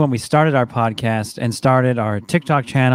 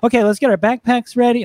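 higher, brisk everyday-mom voice; mom back to normal; 8 semitones higher than usual. Retold or imitated. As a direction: snap out of the work voice into mom mode. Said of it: "snap out of it and go".

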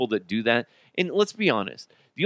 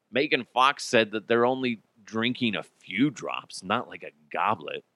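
ordinary talk

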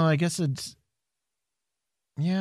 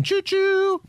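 train whistle. Pretend.

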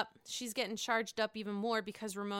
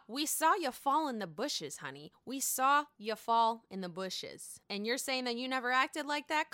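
ordinary talk

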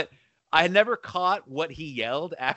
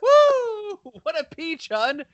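put-on high voice of a thrilled lady; delighted golfer impression; 14 semitones higher, slower than usual. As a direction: imitate her pleased little cheer. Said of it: "Yeah, she loves it".

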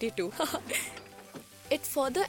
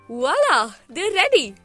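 excited; 4 semitones higher, 11 dB louder than usual. Acted. Onions done, big reveal.

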